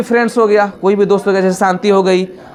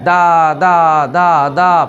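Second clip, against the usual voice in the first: lightly voiced infant; baby babble; 4 semitones lower, much slower than usual. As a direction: lower imitation of baby saying da. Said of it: "सबसे कोई बोलता है पहले दा".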